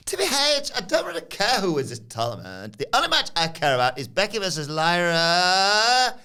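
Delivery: mock wrestler voice, rising to a long squeal; Tiffany Stratton. Host mimics her backstage interview, exaggerated high voice. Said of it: "the reason she says it like that".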